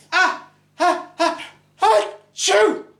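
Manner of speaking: high, loud hammed-up sneeze; high mock sneeze. Bride's third sneeze, high loud mock sneeze. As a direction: climb the ah's high then sneeze. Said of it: "and the bride went".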